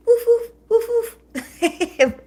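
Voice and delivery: high, loud mock barking; playful dog woofs. Streamer jokes she turned into a dog, playful woofs. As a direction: woof like an excited little dog. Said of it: "Turned into a dog".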